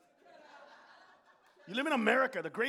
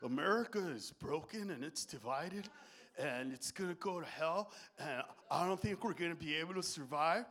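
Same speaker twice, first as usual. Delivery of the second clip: mock gloomy American; 7 semitones lower, 10 dB quieter than usual. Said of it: "Talk to a middle-class American".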